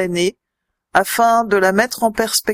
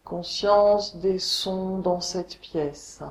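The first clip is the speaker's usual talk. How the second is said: guided meditation; 3 semitones lower, 5 dB quieter than usual. Acted. Yoga teacher guiding listeners lying on the floor, meditation voice. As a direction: guide them unhurriedly into deep rest, letting phrases settle. Leads a Yoga Nidra relaxation.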